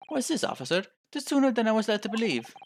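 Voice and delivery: Irish lilt; Irish accent. Acted. Client asking officer about the early summons, Irish accent. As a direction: question it in an Irish brogue. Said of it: "stop doing that accent now".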